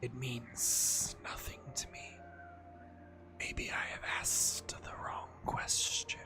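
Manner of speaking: slow whispering serpent voice. Acted. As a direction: breathe it out like an ancient serpent. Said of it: "You like my serpent voice?".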